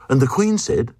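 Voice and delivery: plain reading voice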